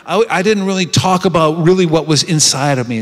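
ordinary talk

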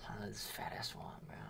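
fast hushed aside; whisper. Real, not acted. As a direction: whisper it so she can't hear. Whispered aside about caller.

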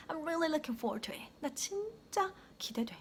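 high, hushed eager anticipation; with feeling. Shown as a demonstration. Teacher showing learners emotion, excited and hushed. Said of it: "감정이랑 같이 표현해 주시면".

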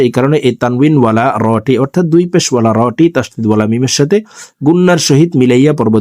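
ordinary talk, nothing marked

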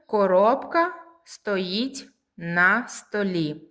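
slow, separated words for pupils; teacher spacing out each word. Shown as a demonstration. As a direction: dictate it slowly, a pause between words. New sentence given word by word.

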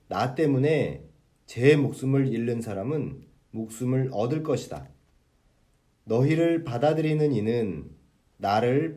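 measured church reading of scripture; gospel reading. Acted. Reads the Sunday gospel aloud.

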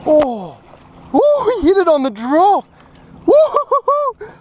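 high, loud whooping over a big strike; excited whoop. Real, not acted. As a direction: cheer as the fish takes the lure. Strong fish hits the lure.

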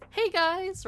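high, perky streamer hello; greeting an imagined audience. Shown as a demonstration. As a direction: greet viewers in a high lilt. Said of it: "I go like".

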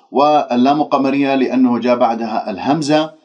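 ordinary talk, nothing marked

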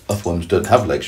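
ordinary delivery, nothing marked

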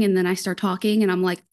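usual speaking voice

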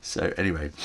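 ordinary delivery

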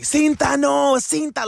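high, loud surprised exclamation; surprised disbelief. Retold or imitated. Friend hears about a lottery win, high and loud in surprise. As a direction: gasp it out like the news is unbelievable. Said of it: "And they'll be like".

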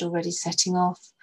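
ordinary delivery, nothing marked

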